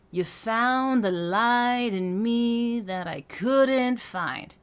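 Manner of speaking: weight on "couldn't"; anchored phrase. Shown as a demonstration. Voice coach placing accents for students. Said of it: "That's where I would put those, um, accents".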